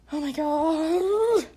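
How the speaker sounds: stunned reaction to creepy texts; drawn-out shock. Real, not acted. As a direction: stretch it out in disbelief.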